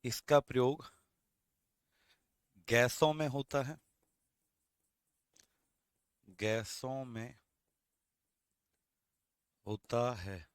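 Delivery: broken into chunks with long pauses; slow dictation. Shown as a demonstration. Teacher dictating the line to students in slow chunks. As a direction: dictate it slowly in pieces. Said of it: "तो आप क्या लिखेंगे?".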